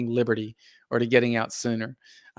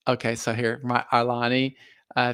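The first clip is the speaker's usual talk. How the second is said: ordinary delivery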